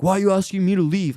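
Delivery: high, indignant protest; retold anger. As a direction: imitate an angry man challenging an order. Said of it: "being angry".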